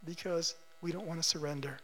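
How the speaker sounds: usual speaking voice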